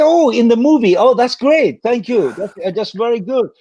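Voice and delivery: high bright mock enthusiasm; mock delighted. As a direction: play up fake relief and delight. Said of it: "I said".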